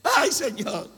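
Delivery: ayes muy agudos y exagerados; creyente lamentándose. Acted. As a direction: parodia un gemido de desesperación. Imita creyente que se autoflagela. (lamento teatral de creyente angustiado)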